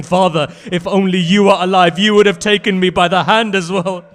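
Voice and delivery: loud, high orphan's plea to a father; anguished lament. Acted. Orphan cries out to dead father.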